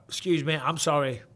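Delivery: usual speaking voice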